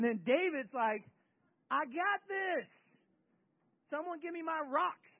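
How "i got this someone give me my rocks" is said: high, loud, confident boast; raised voice, acted bravado; cocky young hero. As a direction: call out eagerly for a fight. Acting David's eager boast before the fight.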